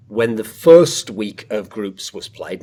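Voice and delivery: slow, drawn-out reminiscing; as though ages ago. Real, not acted. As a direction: stretch it out slowly like distant history. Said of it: "I have to say it like that as though it was ages ago".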